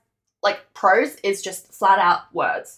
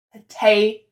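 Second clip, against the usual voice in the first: "Potato" with its second syllable punched louder; stress on the middle syllable; 7 dB louder than usual. Shown as a demonstration. Teacher showing a stressed syllable, middle of "Potato" stressed. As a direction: hit the "ta" in "Potato". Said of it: "You can hear the te is a stress syllable".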